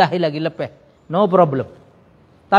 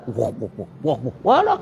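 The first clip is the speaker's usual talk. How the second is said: mock dismay; 9 semitones lower, 6 dB quieter than usual. Mocking loud gargling at mosque.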